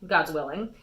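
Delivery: plain reading voice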